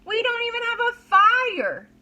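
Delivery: put-on high, loud child's complaint; whiny boy voice. A narrator's reading. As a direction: whine like an upset little boy.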